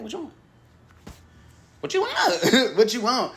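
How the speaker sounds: high sing-song What you want, teasing; high teasing God voice. Acted. God teasing her, high sing-song voice. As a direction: tease the question out high and light. God's voice, high repeated question.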